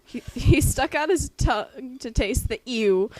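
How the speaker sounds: loud, hammed-up dramatic reading. Friend reading a gross fanfic aloud, loud mock-dramatic reading. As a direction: belt out the fanfic like a stage performance. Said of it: "I'm just gonna read this like a woman".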